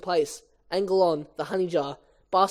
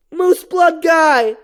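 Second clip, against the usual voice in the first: loud, high, drawn-out shout; calling after someone; 11 semitones higher, 9 dB louder, much slower than usual. Acted. [name] calls after Moose Blood, shouting. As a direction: holler it slowly after a vanishing friend.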